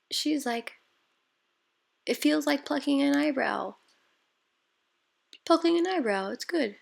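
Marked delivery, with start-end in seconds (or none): 2.1-3.8 higher voice mimicking the client; imitating client. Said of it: "I don't know if that sounded like her at all". 5.4-6.8 higher echo of her words; imitating client. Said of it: "I don't know if that sounded like her at all".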